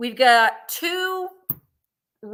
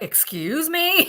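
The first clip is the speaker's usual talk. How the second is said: voicing the dog; 7 semitones higher, slower than usual. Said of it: "That dog is like".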